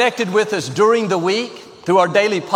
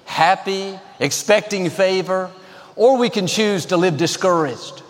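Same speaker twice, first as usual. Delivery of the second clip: ordinary talk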